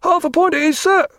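high distant voice from below; ghostly voice. A narrator's reading. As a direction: answer faintly up a speaking tube. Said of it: "the ghostly voice answered".

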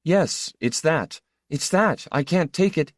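high-pitched, halting outburst; overwhelmed man, pitched well up; stammering distress. A narrator's reading. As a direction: blurt it out in short broken bursts. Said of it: "he stammered".

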